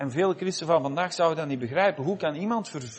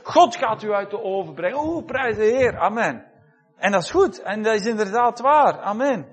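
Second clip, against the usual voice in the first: higher and louder, celebrating victory; exuberant preaching; 6 semitones higher, 5 dB louder than usual. Acted. Preacher celebrating the fiery furnace rescue, exuberantly. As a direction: rouse the congregation with joyful praise. Triumphant preaching with praise shouts.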